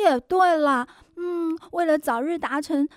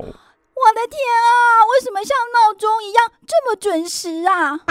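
loud, higher exasperated cry; exasperated exclaim; 5 semitones higher, 6 dB louder than usual. Acted. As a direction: cry out in disbelief. Exasperated at mother's punctual call.

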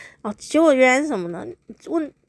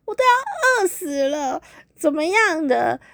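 mock whine; 10 semitones higher than usual. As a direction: drag it out like a neglected girlfriend. Girlfriend whining hungry.

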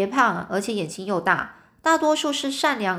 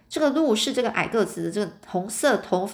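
usual speaking voice

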